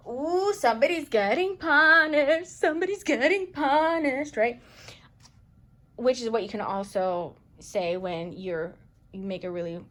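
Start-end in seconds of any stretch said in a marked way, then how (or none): 0.1-4.4 a teasing, gleeful put-on voice; mocking gleeful voice. Mocking how people hear karma, gleeful singsong. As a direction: mimic people gloating over punishment. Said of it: "Their head goes to".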